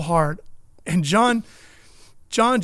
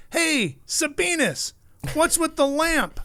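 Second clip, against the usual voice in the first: very high, slow calling-out impression; John Force; 10 semitones higher, slower than usual. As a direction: imitate a puzzled guest, pitched way up and stretched. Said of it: "and he goes".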